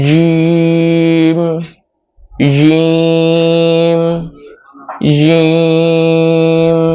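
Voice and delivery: জিম drawn out very slowly, tongue middle on the palate, no air; demonstrating jim. Shown as a demonstration. Teacher drilling জিম, long held demonstration. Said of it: "দেখুন".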